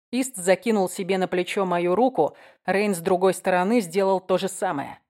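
ordinary delivery, nothing marked

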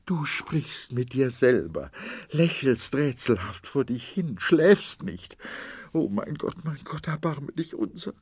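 high, rising, desperate voice; wife lists his symptoms in horror, praying; horrified. A narrator's reading. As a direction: pray in terror. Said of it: "Sie sprach voll Entsetzen".

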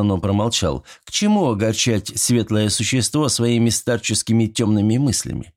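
plain reading voice

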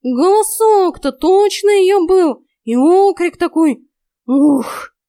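high, loud, drawn-out cat's outburst; indignant cat. A narrator's reading. Cat Vaska protests to the heroine, indignant. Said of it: "возмутился Васька".

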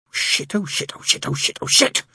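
Crowley curses alone in the car, panicked mutter. A narrator's reading. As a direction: curse under the breath then plead. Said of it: "he muttered".

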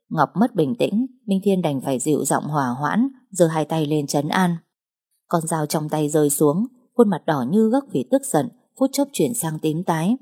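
ordinary delivery, nothing marked

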